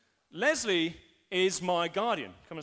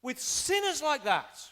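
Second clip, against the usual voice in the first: loud voice in the room; 7 semitones higher than usual. Acted. Critic rebuking believers for eating with sinners, acted indignation. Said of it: "The loud voice in the room says".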